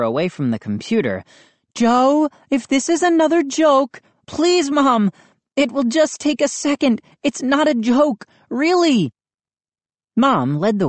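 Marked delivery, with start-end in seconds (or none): 1.7-9.1 high, pleading exchange; boy pleading with a doubtful mother; voices of mother and pleading son. A narrator's reading. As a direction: beg her to believe.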